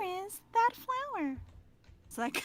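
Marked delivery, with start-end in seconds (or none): 0.0-1.4 put-on high, slow kids' show voice; Dora energy. Voicing the game character looking for a flower, Dora-like. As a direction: ask it like a children's cartoon host. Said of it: "it's very Dora energy".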